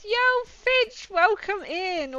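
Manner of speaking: high, slow, drawn-out welcome; excited greeting. Real, not acted. Streamer welcoming Finch to the stream, high and drawn out. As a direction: sing out the welcome to the newcomer.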